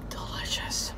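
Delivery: whispered, savouring; whispered delicious. Real, not acted. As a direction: breathe it out with pleasure. Smelling the pops.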